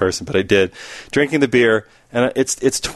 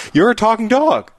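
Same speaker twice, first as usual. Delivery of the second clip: high, excited burst of disbelief; mock-thrilled astonishment; 11 semitones higher than usual. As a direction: exclaim it with hammed-up wonder. Bartender repeats, more amazed.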